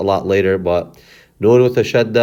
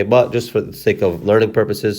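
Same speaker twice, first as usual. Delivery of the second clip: normal talking voice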